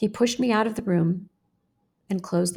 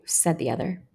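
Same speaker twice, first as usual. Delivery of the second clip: ordinary delivery, nothing marked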